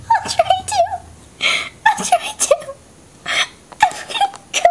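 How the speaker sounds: high, thin ghostly wail. Acted. To Nikki, she speaks like a ghost. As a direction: moan it out like a ghost. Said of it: "Why are you talking like you're a ghost".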